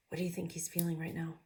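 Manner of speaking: ordinary delivery, nothing marked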